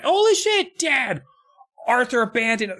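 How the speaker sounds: squealing high, loud relief; shocked excited cry. Retold or imitated. Kids shrieking at their dad in high, loud shock. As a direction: yelp it like a startled kid.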